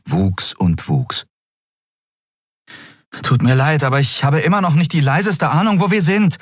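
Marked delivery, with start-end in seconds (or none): 3.2-6.4 higher voice, calling across the room; called out. Acted. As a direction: call to the fairy across the room. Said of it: "rief sie Nelen zu".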